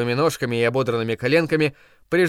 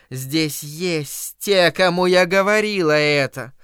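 slow, doom-laden prophecy from a zealot; fanatic old woman prophesying; 3 semitones higher, slower than usual. Acted. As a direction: preach doom like a fanatic crone. Mrs Carmody's doom prophecy.

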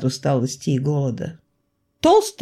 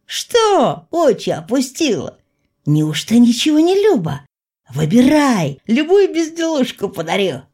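flirting merchant; 6 semitones higher, 4 dB louder than usual. Acted. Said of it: "засмеялся Рыжий".

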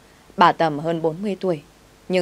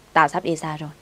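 ordinary delivery

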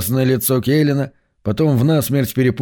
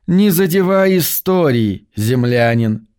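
slow, drawn-out words; drawling the words; 4 semitones higher, slower than usual. Acted. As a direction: stretch every word lazily. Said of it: "затягивая слова".

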